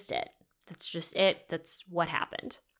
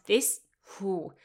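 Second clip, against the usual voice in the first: ordinary delivery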